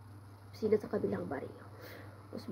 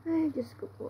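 higher-pitched exasperated exclamation; real exasperation at a cold that won't go away; exasperated cry; 7 semitones higher than usual. Real, not acted. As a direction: throw up your hands at the cold, pitched up. Fed up with a cold.

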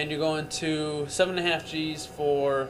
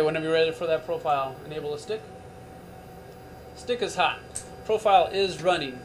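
usual speaking voice

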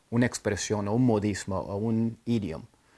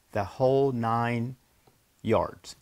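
slow, soft modeled idiom; 4 dB quieter, much slower than usual. Shown as a demonstration. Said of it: "entonces ahí está".

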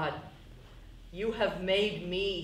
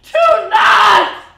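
slow, desperate scream, much higher and louder; 19 dB louder, slower than usual. Acted. Carol screaming at Eric over the ashes, desperate shout. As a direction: scream it out, dragging each word.

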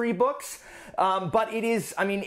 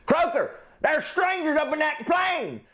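shouted high voice; 11 semitones higher than usual. Retold or imitated. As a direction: yell the warning in a silly high voice. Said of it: "However you want".

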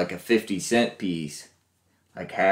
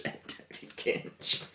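laughing whisper; 13 dB quieter than usual. Real, not acted. Laughing over the word.

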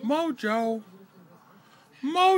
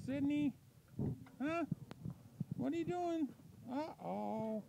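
soft cooing; 4 semitones higher, 13 dB quieter than usual. Real, not acted. Cooing softly to a pet bird.

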